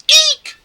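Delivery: very high, loud "eek"; mock frightened squeal. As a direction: let out a hammy little shriek. Character squeals at ghost.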